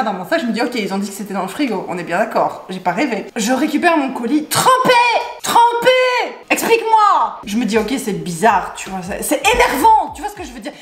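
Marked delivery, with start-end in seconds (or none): 3.4-7.4 indignant. Real, not acted. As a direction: spit out soaked in outrage. Indignant about soaked parcel. (indignation criée sur trempé)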